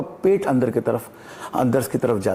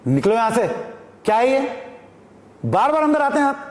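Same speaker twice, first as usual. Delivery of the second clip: angry shout; 8 semitones higher than usual. Shown as a demonstration. Director shouting at a man who keeps barging in, angrily. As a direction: throw him out, fed up and furious. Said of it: "ये जिस तरीके से मैं बोल रहा हूँ".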